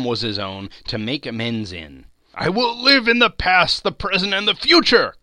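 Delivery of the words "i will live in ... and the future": high, loud and elated; fervent repeated vow. A narrator's reading. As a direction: declare it eagerly with all your heart. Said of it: "Scrooge repeated as he scrambled out of bed".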